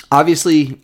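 usual speaking voice

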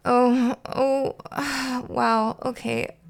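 swallowed disappointment with a sigh; controlled upset. A narrator's reading. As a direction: hold back tears and make do. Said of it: "Tears filled his big round eyes, but somehow he controlled himself".

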